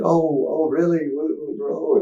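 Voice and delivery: high, mock alarmed teacher voice; a lightly voiced teacher caught off guard; flustered teacher. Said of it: "she's like".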